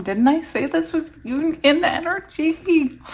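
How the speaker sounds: high, stretched-out excited question; excited vindication. Real, not acted. Reader finds her words confirmed, excited. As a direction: rise into gleeful disbelief. Vindicated by what she reads.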